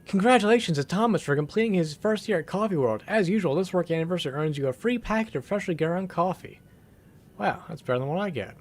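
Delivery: reading the weekly staff news like a chipper announcer. Acted. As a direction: read it out like a cheery office announcement. Reads in-game news bulletin aloud.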